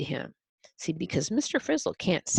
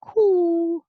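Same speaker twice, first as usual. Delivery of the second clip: very high, louder cooing; baby coo; 13 semitones higher, 8 dB louder than usual. A narrator's reading. Baby ghost in the cradle cooing, high cooing sound. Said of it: "he heard a soft coo".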